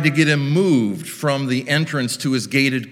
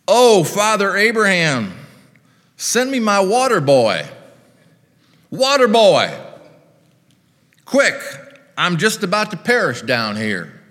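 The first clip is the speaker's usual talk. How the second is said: put-on Southern accent calling out; 7 semitones higher, 4 dB louder than usual. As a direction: holler for help in a Southern accent. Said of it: "imagines the rich man calling out".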